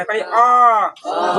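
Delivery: पहले अ with the अ stretched slowly; long drawn vowel. Shown as a demonstration. Teacher to students, stretching the vowel slowly. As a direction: hold the अ out on one breath. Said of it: "भैया लंबा सांस खींच रहा है".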